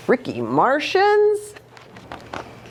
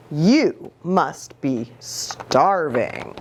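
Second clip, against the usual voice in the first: tender mother; 6 semitones lower, much slower than usual. Acted. Mama fussing over son.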